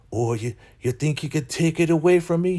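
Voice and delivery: high put-on taunting voice; imitated defiance. Recapper voicing stubborn Elder Mother to Daikon, high mock defiance. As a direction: play her daring him, pitched well up.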